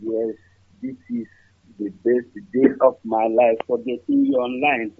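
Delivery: faint voice far from the phone; speaking very low and distant; barely audible. Real, not acted. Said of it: "Your voice is too low".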